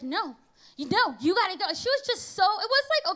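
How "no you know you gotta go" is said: usual speaking voice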